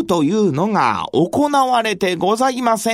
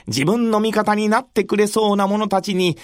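ordinary delivery, nothing marked

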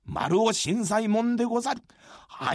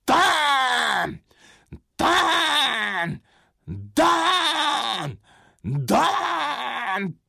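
pounding on a gate; 2 semitones higher, much slower than usual. Acted. Gate pounding sound effect.

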